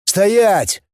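high-pitched command, no added volume; contained narrator's shout. A narrator's reading. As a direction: call out a sharp order. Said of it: "и крикнул".

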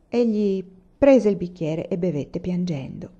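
ordinary delivery

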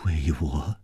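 low, soft echoing question; hushed disbelief. Acted. Lover questions revenge on their behalf, hushed. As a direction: echo it quietly, taken aback.

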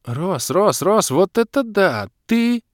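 high, loud, mounting excitement; gushing, astonished girl; amazed squealing. A narrator's reading. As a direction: build to an ecstatic squeal.

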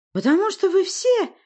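very high, loud shriek; screamed accusation. A narrator's reading. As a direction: scream it at everyone. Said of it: "прокричала Власта".

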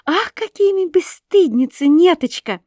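mock scolding girl; teasing exclaim. A narrator's reading. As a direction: tease her friend loudly.

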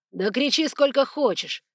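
slow, loud growl. Acted. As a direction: snarl it down at her. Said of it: "прорычал он".

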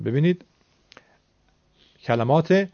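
ordinary delivery

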